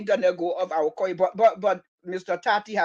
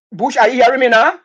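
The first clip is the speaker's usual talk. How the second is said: loud, raised call down the line; 5 semitones higher, 11 dB louder than usual. Real, not acted. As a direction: check loudly if the caller can hear. Checking on caller over bad line.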